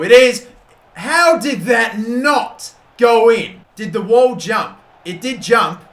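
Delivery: high, loud, slowed-down disbelief. Real, not acted. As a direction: ask how that missed, stunned. Free kick hits the wall.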